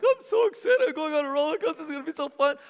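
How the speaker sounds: high, fast excited daughter voice; excited little girl. Retold or imitated. Father voicing his daughter before the drop, high excited. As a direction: bubble over like a kid before the ride. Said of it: "who was up to that point, like".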